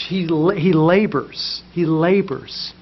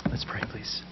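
soft unvoiced whisper; 6 semitones lower, 15 dB quieter than usual. Real, not acted. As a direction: barely breathe it. Whispered call to prayer.

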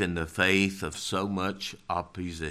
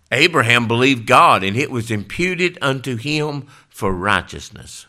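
loud, high pulpit reading; scripture proclaimed; 5 semitones higher, 11 dB louder than usual. Acted. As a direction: ring it out over the congregation. Preaching scripture.

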